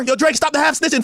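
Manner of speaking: ordinary delivery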